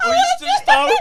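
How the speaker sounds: high, laughing yelp of disbelief; laughing shout. Real, not acted. Mate cracking up at the podcast dare, laughing shout. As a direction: blurt it out through a laugh.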